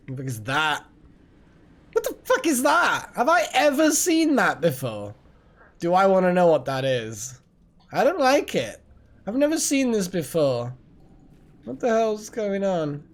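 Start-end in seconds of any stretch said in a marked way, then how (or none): 1.9-3.1 high, loud disbelief; shocked. Real, not acted. Strange monster appears in game. 3.2-13.0 higher, louder startled rant; rattled and disgusted; alarmed. Real, not acted. Reacting to strange monster.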